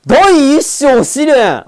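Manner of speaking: high, very drawn-out title call; slow, booming-up announcer flourish; grand title announcement. Real, not acted. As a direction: read it out like a big chapter reveal. Reads out chapter title grandly.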